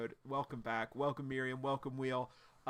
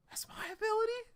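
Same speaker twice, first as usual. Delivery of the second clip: stunned question. Retold or imitated. Remy amazed at his stolen power.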